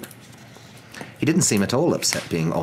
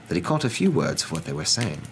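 ordinary delivery, nothing marked

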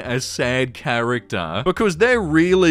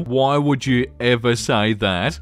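ordinary talk

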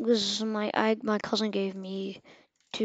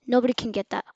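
ordinary talk, nothing marked

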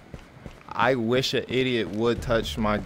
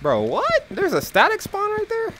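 much higher, astonished question; surprised. Real, not acted. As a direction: ask it in disbelief. Surprised at static spawn.

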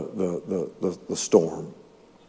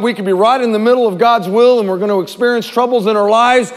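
usual speaking voice

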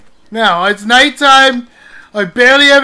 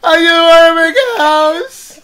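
loud high wailing complaint; plain take then a high crying wail; crying whine; 6 semitones higher, 4 dB louder than usual. Real, not acted. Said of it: "Stop crying".

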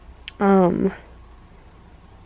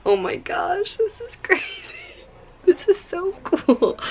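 much higher, thrilled exclamations; amazed; 12 semitones higher than usual. Real, not acted. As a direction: gush over the footage in delight. Amazed by camera footage.

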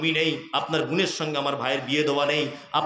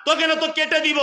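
threatening thief; 11 semitones higher, 4 dB louder than usual. Acted. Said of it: "তা বলছে". (চোরের উঁচু জোরালো হুমকি)

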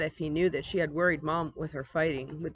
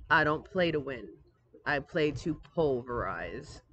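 gravelly voice; 4 semitones lower than usual. Acted. Brother boasting to sister in his gravelly voice, lightly done. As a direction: play the tough guy. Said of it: "in that gravelly voice".

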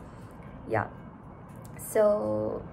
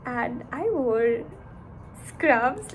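ordinary talk

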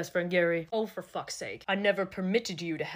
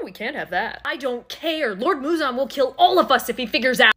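ordinary talk, nothing marked

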